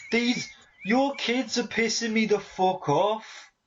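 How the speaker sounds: high-pitched, hammed-up Brummie grumble; Brummie dog. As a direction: complain in a put-on, high Brummie voice. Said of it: "just imagine a Brummie going".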